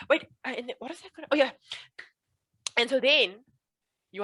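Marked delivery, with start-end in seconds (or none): 0.1-1.5 quick, soft, searching mumble; mock searching. Shown as a demonstration. Speaker demos stopping to regroup, mock searching. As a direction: act like you're hunting for the word. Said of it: "you can just stop and go".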